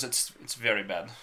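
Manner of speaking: badly faked Indian accent, Russian-sounding; attempted Indian accent. Man quoting his Indian coworker's verdict, failed Indian accent. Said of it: "tried to do an Indian accent".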